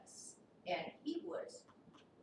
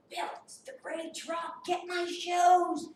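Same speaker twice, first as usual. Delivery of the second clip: calling out; 9 semitones higher, 9 dB louder, slower than usual. Retold or imitated. Calling to husband about truck.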